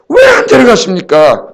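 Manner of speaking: high, slow, crying-out complaint; exasperated protest. Retold or imitated. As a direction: cry out to God in retold frustration. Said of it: "그런 생각을 해본 적이 있어요". (하나님께 따지듯 높고 길게 외치는 항의)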